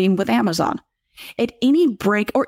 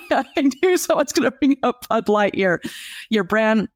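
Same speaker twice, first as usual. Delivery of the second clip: higher voice riding out a laugh; amused, laughing; 3 semitones higher than usual. Real, not acted. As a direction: say it through the tail of a laugh. Still laughing after the comment.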